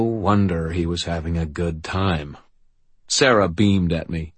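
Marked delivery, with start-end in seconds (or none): none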